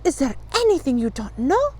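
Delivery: put-on mild girlish wonder; amazed gasp. A narrator's reading. Fanchon marvels at Encyclopedia's knowledge, amazed. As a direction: ask with a touch of awe. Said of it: "Fanchon gasped".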